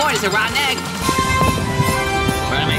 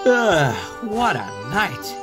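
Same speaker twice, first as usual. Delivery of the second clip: long, slow exhausted sigh into words; weary relief; much slower than usual. Acted. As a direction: sigh it out after a hard night's work. Sighing home after long night.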